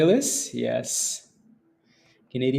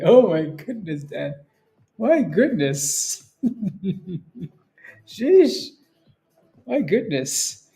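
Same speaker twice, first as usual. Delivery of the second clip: amused exclamation; 5 semitones higher, much slower than usual. Real, not acted. Amused by the chat.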